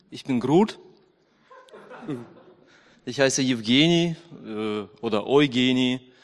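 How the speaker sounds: ordinary talk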